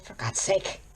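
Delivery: ordinary delivery, nothing marked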